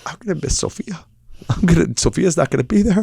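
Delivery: imitated tearful child, high and small; trying not to cry. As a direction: act a kid holding back tears. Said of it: "She was trying not to cry".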